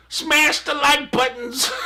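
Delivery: high, drawn-out slogan with a laugh; mock YouTuber. Host parodies what YouTubers say, high laughing mock YouTuber. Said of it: "It's just something we say".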